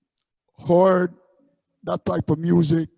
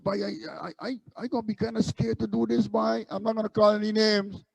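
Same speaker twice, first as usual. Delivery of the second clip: higher, softer Guyanese accent, retold friend; a friend's voice in a light Guyanese accent, a bit higher; 5 semitones higher, 4 dB quieter than usual. Fellow Guyanese musician tells the busker he'd be scared, light Guyanese accent. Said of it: "in his Guyanese accent".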